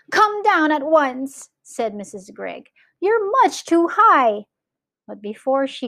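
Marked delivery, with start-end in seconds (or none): 0.1-1.5 high, urgent call; calling out sternly. A narrator's reading. Mother calls to flying sons. 3.0-4.5 high-pitched warning call; worried calling out. A narrator's reading. Mother calls to flying sons.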